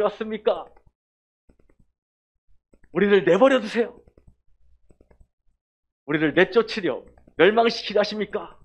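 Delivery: high, loud possessed man's cry; demon shouting. Acted. Demon crying out at Jesus to be left alone, loud. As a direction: yell at Jesus like a cornered demon. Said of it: "막 소리를 치는 거야".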